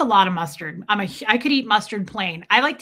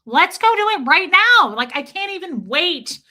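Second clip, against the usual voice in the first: high, loud eager voice; fake enthusiasm; 8 semitones higher, 7 dB louder than usual. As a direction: gush like you can't wait. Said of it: "Next time I need to be like".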